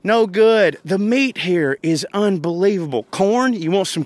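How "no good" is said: mocking critics. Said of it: "People also shit on Midwest".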